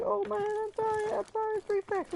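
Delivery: very high squeaky mock-panicked apology; frantic apology. Apologizing to crying cousin after the slap, mock-frantic squeal. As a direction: squeal a hammed-up sorry in a put-on high voice.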